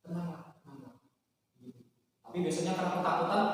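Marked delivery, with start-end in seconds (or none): 0.0-0.9 inner whisper. Acted. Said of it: "bisikan itu".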